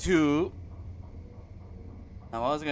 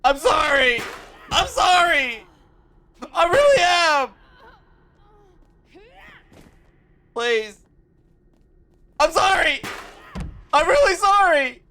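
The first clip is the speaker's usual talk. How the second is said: higher and louder, pleading; half-joking loud apology to Asuka; 13 semitones higher, 6 dB louder than usual. Real, not acted. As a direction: beg her forgiveness over the game. Apologizing to the game character.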